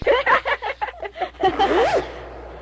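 a real high laugh rising up; big laugh. Real, not acted. Host laughing as the ladies come in, a real rising laugh. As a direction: burst out laughing, climbing high.

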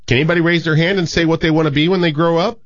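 a hint of a schoolteacher addressing pupils; teacher.

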